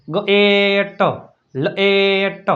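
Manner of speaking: ગ એ ટ, લ એ ટ with long middle vowels; sounding out. Shown as a demonstration. Teacher spelling by sound, ગ એ ટ drawn out slowly. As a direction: stretch એ, then drop ટ in separately. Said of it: "મ plus".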